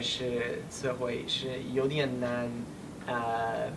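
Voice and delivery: normal talking voice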